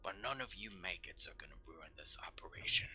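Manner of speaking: hissed threat; menacing whisper. Acted. Sergeant threatening the newcomers in a whisper. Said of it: "points to you all and says".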